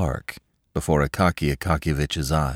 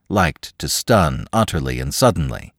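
ordinary delivery, nothing marked

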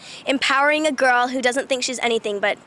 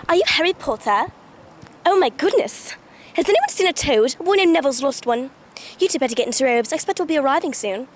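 Hermione. Shown as a demonstration. Showing a friend the Hermione accent, English accent. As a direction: speak in a prim English accent. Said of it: "do the Hermione accent".

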